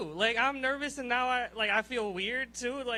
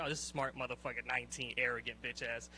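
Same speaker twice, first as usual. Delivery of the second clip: low, soft grumbling impression of the annoyed officer; quietly sneering official, much lower and softer; upset officer; 11 semitones lower, 8 dB quieter than usual. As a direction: mutter it low like the irritated clerk sizing him up. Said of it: "she got upset with me".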